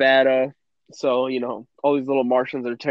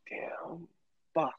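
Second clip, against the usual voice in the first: muttered curse; 6 dB quieter, slower than usual. Retold or imitated. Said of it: "you're like".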